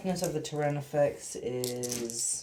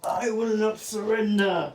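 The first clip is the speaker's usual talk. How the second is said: defiant; 7 semitones higher, 7 dB louder than usual. Acted. Defiant declaration in game.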